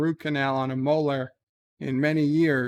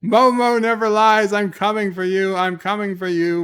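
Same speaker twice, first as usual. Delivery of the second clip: menacing villain; 5 semitones higher, 6 dB louder than usual. Acted. Reads Momo's menacing super chat.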